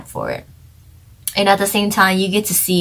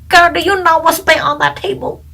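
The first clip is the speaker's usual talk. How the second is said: put-on light Chinese accent, higher voice; 10 semitones higher, 3 dB louder than usual. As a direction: slip into a playful Chinese accent. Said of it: "there goes my Chinese accent again".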